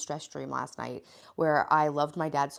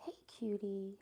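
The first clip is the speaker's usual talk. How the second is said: soft, higher whispered coo; 5 semitones higher, 10 dB quieter than usual. Real, not acted. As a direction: coo at the little one. Cooing to a pet.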